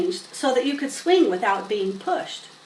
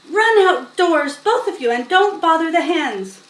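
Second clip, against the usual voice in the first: stern shooing; 5 semitones higher, 6 dB louder than usual. A narrator's reading. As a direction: shoo the children out. Missus Zuckerman shooing children.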